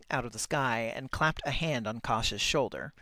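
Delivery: ordinary delivery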